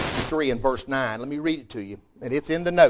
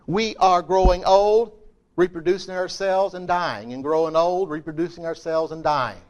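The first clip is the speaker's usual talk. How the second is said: rhythmic preaching; 5 semitones higher, 5 dB louder than usual. Acted. Preaching repetition.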